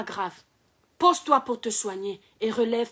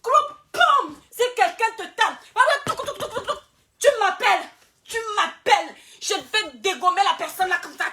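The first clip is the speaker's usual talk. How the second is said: playful tough-guy boast, raised high; hyped-up boast; 8 semitones higher than usual. Acted. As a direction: hype yourself up like a fighter's bodyguard. Hyping himself as bodyguard.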